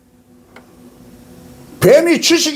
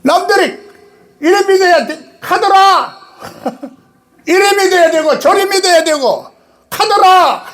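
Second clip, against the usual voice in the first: mocking hearsay; 10 semitones higher than usual. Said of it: "경상도 말로 카더라 하니?".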